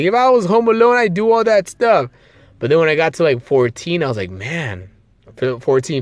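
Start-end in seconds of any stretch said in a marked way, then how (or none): 0.0-2.1 put-on excited kid. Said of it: "I'd be like".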